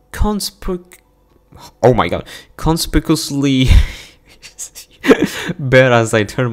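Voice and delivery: high, laughing struggle through the word; cracking up. Real, not acted. Narrator stumbles over a long word, real laughter breaking in. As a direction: lose it laughing while trying to narrate.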